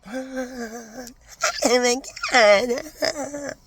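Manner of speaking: gushing office manager. Retold or imitated. Office manager voiced in story.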